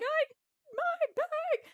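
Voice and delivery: very high, loud mock wail; freaking out. Traveler mourning her bag, high panicked wail. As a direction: whine it out in hammed-up panic. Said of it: "And I'm freaking out".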